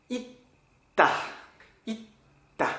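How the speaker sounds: "Itta" in two parts with a held stop; stopped consonant. Shown as a demonstration. Teacher repeating the correct form, "Itta" with a clear stop. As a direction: cut off the first syllable of "Itta", then ta. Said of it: "Ça s'arrête la prononciation".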